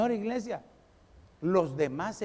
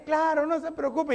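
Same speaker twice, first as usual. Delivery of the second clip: fake agreeable; 6 semitones higher than usual. Fake willing reply to order.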